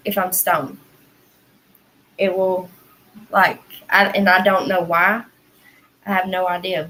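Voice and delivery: ordinary talk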